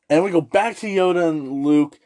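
ordinary talk

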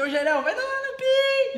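"Ô, Jairão, vai tomar no pi" called out high, ending on a long held "pi"; intonation demo. Shown as a demonstration. Showing the co-host how intonation changes an insult, high-pitched call-out. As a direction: sing out the insult and stretch "pi" high. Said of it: "A entonação, ela muda".

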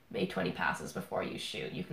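low, hushed imitation of a coach; quiet coach instruction. Coach discreetly telling his winning team to ease off, quiet. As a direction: say it under your breath to the team. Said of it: "say that to his team quietly".

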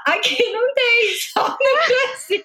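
high, drawn-out self-imitation, near laughter; mock yourself explaining slowly, pitched high. Embarrassed customer replaying her explanation to the clerk, mock slow. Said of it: "comecei a falar bem devagar".

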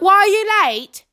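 high, loud mock teacher demand; imitated scolding teacher. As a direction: mimic a teacher pouncing on a latecomer. Retold homeroom teacher scolding.

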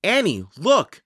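calling out a find; excited shout. A narrator's reading. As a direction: shout to get her attention. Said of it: "shouted Jack".

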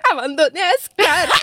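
half-laughing imitation of her own sobbing; hammed-up retold sob; mock crying wail.

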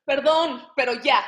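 fuerte y tajante. Acted. Final blow, loud.

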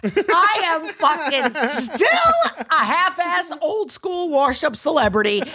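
loud, hammed-up self-mocking pride; proud declaration. Real, not acted. Washed-up celebrity defending her makeup, loudly proud. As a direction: proclaim it like a faded star.

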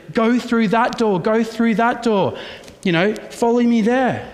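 mock false guide calling. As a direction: point them the wrong way with urgency. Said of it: "and I said".

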